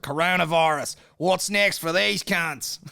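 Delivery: loud, high Australian newsreader parody; slow, loud hammed-up Australian accent; broad Australian documentary voice-over, hammed up; mock Australian. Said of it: "the Australian ones are classic".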